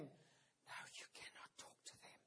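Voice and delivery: firm but voiceless whisper. Retold or imitated. Child whispering a refusal to the caller. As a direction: hush it out, no voice at all. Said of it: "the whispered response".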